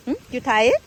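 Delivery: hushing a pet. Real, not acted. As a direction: ask the dog gently, low. Soft low question to pet.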